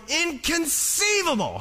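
high, drawn-out mock cry of Inconceivable; a hammed-up movie villain's outburst; Inconceivable exclaimed. Preacher quoting a movie character, shouting high and slow. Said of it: "this one guy who keeps going".

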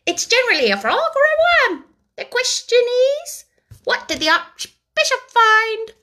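high, blunt, matter-of-fact duck. A narrator's reading. The duck answers the mouse, high and blunt. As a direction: state it bluntly like a practical duck.

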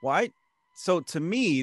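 ordinary talk

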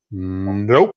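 a short flat playful refusal; blunt nope. Retold or imitated. Refusing a request with a playful blunt no. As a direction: clip it off with a cheeky no. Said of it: "he gives the example of".

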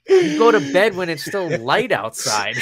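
high, loud mock exasperation; retold incredulous outburst; exasperated retort. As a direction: protest in comic disbelief, half laughing.

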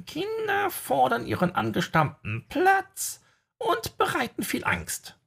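very high, slow prophecy voice; eerie seer voice. Acted. Seer delivering prophecy, eerie high voice.

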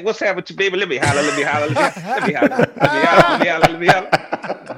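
pushy pickup artist, repetitive and loud; Pud. Acted. Friend mocks a guy pestering a girl, hammed-up pushy come-on. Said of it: "little small, ugly motherfuckers like Pud just come at you".

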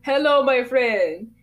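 put-on loud, slow Godfather accent greeting. Regular customer Boston greeting the drive-thru, slow Godfather accent. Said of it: "this Godfather kinda accent".

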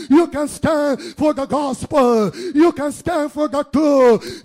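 sermon swelling from firm to high, loud shouting; rousing preaching. Real, not acted. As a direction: build steadily to a full-throated exhortation.

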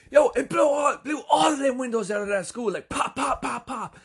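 very high mock shout, easing through the pops; excited emphasizer. Henry repeats Mike while jumping, imitated in a wildly excited shout. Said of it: "Henry gets excited by what's happening".

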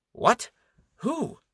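very high startled yelps; shocked. Acted. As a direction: gasp out the questions. Nganyon is shocked.

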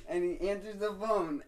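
ordinary talk, nothing marked

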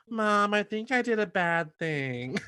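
high, slow mock-sheepish confession; daughter confessing to mom. Contestant on the phone to her mom, sheepish and high. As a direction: draw it out high like a guilty kid. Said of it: "her on the phone with her mom".

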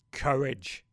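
a lightly voiced stirring dad's command; solemn rallying word. Father imitated telling crying son to have courage, solemn and low. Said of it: "channeled my inner Rog".

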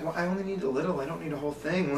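lightly imitated grandma, soft and casual; quiet little justification, retold; mild mock excuse. Grandma excusing her theft, retold.

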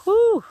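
high, loud exhaled whew; hot "whew". Real, not acted. Walking outside in the heat, a loud relieved-from-nothing "whew". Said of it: "it's hot out here".

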